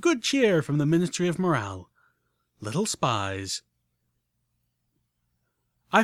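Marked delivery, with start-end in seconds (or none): 2.6-3.6 dark aside. A narrator's reading. Dark punchline after cheerful list.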